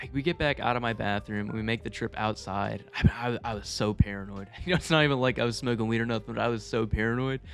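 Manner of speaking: usual speaking voice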